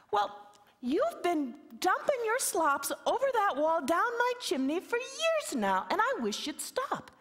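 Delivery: high, thin complaining voice; little man grumbling about his chimney; wee fella. Acted. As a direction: plead in a tiny fella's voice.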